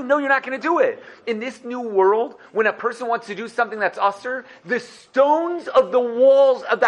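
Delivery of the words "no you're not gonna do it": high, insistent playful scolding; half-yelled comic protest; mock screamed refusal. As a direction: forbid it in a raised, high voice. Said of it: "gonna scream at you and tell you".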